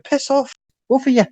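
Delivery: sharp and high, brushing them off; irritated dismissal. Acted. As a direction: tell them both to get lost. GM's NPC dismisses the players.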